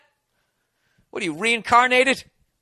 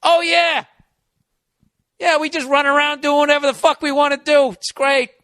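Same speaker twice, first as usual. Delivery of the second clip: sarcastic boast; 8 semitones higher, 4 dB louder than usual. Acted. Sarcastic mock boast about privilege.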